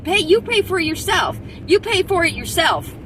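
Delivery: loud, high-pitched outburst; real exasperated demand; indignant. Real, not acted. Angry rant at officials' paid protection.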